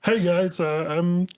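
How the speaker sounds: a hint of frog puppet; Kermit the Frog. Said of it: "All your voices are Kermit".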